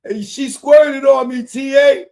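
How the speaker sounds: high, loud excited holler. Acted. Reader acting out the rapper hollering to his friend, shouting. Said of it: "[name] began shouting".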